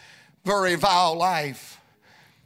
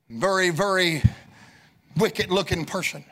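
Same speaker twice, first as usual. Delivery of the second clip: ordinary talk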